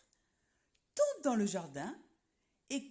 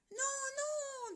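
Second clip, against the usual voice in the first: much higher tiny galette voice; galette refusing; 13 semitones higher than usual. Acted. Galette to the wolf, tiny high refusal. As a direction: squeak it out small and high. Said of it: "dit la galette".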